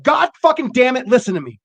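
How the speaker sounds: high, loud, mock-serious outburst; serious angry demand. Shown as a demonstration. Showing a friend how swearing signals seriousness, loud. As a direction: bark it like you mean business. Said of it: "So, like, if I'm like".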